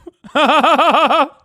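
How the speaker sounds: bursting out laughing, loud and high; a real loud laugh at the ending. Real, not acted. Big laugh at the story.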